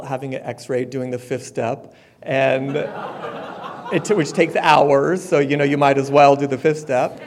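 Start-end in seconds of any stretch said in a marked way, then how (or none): 2.2-7.2 laughing. Real, not acted. Laughing over the hospital fifth step.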